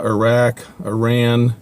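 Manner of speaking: ordinary talk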